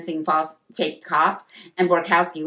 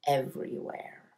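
slow, whispered cliffhanger; very soft and drawn out; hushed; 3 semitones lower, 9 dB quieter than usual. A narrator's reading. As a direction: let it sink to an eerie hush. Eerie chapter ending about ghost.

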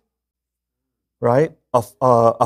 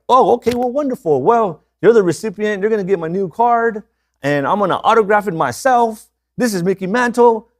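higher put-on caller voice, playing the famous ballplayer; hammed-up Mickey Mantle voice; 7 semitones higher than usual. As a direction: pitch it up and ham up the fake celebrity on the phone. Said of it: "I disguised my voice as".

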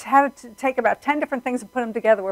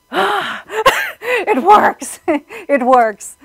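high, loud laughing exclamation; overjoyed relief; 10 semitones higher, 7 dB louder, much slower than usual. Retold or imitated. Acting out the team's joy.

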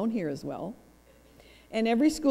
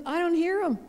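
husband's reply repeated; 8 semitones higher, 3 dB louder, faster than usual. Retold or imitated. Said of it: "He goes".

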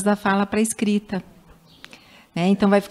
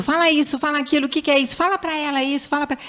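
higher insistent nagging voice; pushy parent; 7 semitones higher, faster than usual. Retold or imitated. Parents pressuring the child to talk, higher insistent nagging. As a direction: nag the child to speak. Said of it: "os pais ficam muito".

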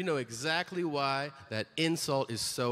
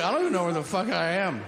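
ordinary talk

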